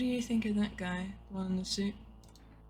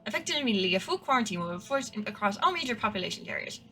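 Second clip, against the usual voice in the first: louder, higher mock news-anchor delivery; news anchor read; 4 semitones higher, 4 dB louder than usual. Reading the FEMA broadcast to viewers as a newscaster. Reads the game's TV news aloud.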